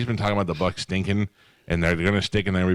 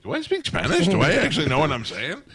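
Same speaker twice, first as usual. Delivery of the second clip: mock surprise; 9 semitones higher than usual. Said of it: "all of a sudden I'm like".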